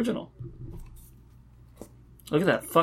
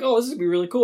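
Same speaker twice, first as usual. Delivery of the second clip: excited; 8 semitones higher, 5 dB louder, much faster than usual. Retold or imitated. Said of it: "I'm like".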